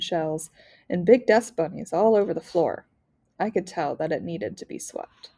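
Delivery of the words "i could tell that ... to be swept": plain reading voice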